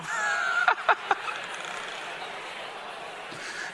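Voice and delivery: high squealing laugh. Real, not acted. Pastor laughing at crowd.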